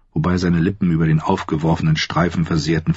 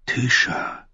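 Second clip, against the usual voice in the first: whispered name, softer; breathy murmur; 7 semitones higher, 4 dB quieter than usual. A narrator's reading. Tyrion's inner murmur. (geflüstert)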